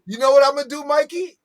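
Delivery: high playful tease; teasing, pitch it up; excited. Real, not acted.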